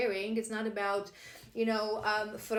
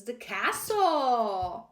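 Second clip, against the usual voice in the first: very slow, louder drawn-out announcement; dramatic card reveal; 6 dB louder, much slower than usual. Real, not acted. Revealing the drawn card to viewers, slow dramatic announcement. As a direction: unveil the card name like a reveal.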